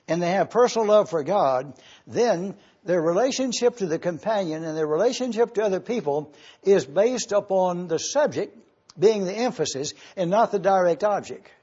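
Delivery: ordinary talk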